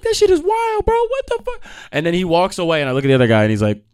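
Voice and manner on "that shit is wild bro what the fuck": astonished. Retold or imitated. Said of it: "I was like".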